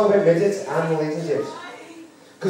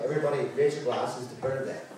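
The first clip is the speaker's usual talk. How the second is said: mock solemn toast; 4 semitones lower, 12 dB quieter, faster than usual. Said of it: "immediately I just went".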